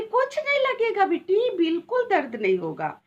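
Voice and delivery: high, sweet-talking mother to her daughter; mother lightly soothing her daughter before the ear piercing; coaxing mother. Retold or imitated. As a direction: coax the little girl, voice lifted high. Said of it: "बोली".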